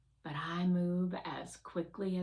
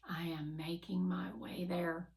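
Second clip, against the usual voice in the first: plain reading voice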